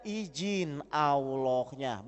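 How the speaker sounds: very slow, drawn-out answer; slow punchline. Real, not acted. Preacher answering his own question, slow and weighty. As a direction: stretch every syllable for weight.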